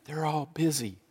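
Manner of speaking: put-on hushed little boy; child whispering. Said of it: "Still whispering".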